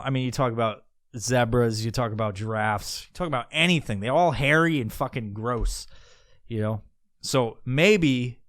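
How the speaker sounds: normal talking voice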